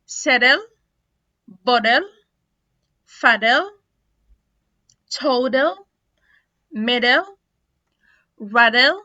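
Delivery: loud separate words, "settle" as "seddle"; "bottle" as "boddle", D for T; American flapped T. Shown as a demonstration. Teacher drilling T plus L examples, flapped T. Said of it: "هيتنطق D".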